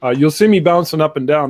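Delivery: normal talking voice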